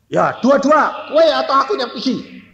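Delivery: high, mock heated challenge; acted quarrel. Parent arguing over who moves out, mock angry challenge. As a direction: throw the challenge back, pitched high.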